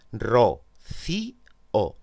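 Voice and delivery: "Ro, ci, o" syllable by syllable, "i" apart from "o"; hiatus in separate syllables. Shown as a demonstration. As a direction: split "Ro, ci, o" with the stress on "ci". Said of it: "se pronuncian en sílabas distintas".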